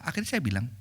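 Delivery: ordinary talk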